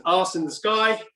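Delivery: normal talking voice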